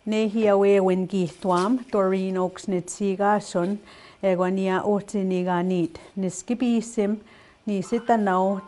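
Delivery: fluent Cree, words run together like a native speaker. Shown as a demonstration. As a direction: say it the way a Cree speaker naturally would. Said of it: "how you would hear a Cree speaker saying it".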